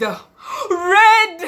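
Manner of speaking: high, loud burst on one word; loud exclaimed punchline. Real, not acted. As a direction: belt out the word like a reveal. Punchline after slow build-up.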